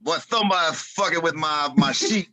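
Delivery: high-pitched mimicry of a heated wrestler; mock-indignant wrestler impression; Dream. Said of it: "And he's like".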